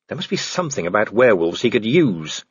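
ordinary delivery

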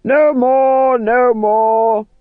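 loud, high, commanding clap to stop; firm call. Acted. Vitola ending the recitation for the company, loud firm call. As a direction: call the company back to work briskly. Actor-manager breaking the spell loudly.

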